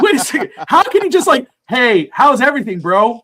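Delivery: high and loud, laughing through the disbelief; incredulous laughing. Retold or imitated. Retelling his reaction to the text, incredulous laughter. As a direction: play up the shock while cracking up.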